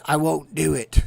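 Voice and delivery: mock brooding hero refusing, slowing on the second line; Jon Snow. Vlogger imitating Jon Snow refusing to bend the knee. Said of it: "He said".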